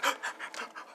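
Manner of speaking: startled breathy gasp; scared gasp. Acted. Boy grabbed at five in the morning, scared gasp. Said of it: "Deku gets scared".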